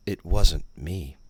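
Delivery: ordinary delivery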